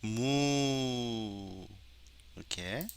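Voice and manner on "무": long, drawn-out hummed moo; cow moo imitation. Shown as a demonstration. Teacher recording cow samples for the computer, imitated mooing. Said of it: "소".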